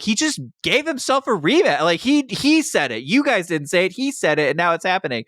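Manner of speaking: high-pitched indignant complaint; mock angry rant. Randy Orton protesting Cena's rematch to officials, high mock-angry whine. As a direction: whine it like a cheated champion. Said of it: "so mad".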